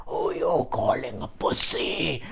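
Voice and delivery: slow, quiet seething retort; softly acted, drawn-out fury. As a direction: re-enact suppressed rage, drawing the words out. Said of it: "I was seething with hate".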